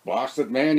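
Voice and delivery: ordinary delivery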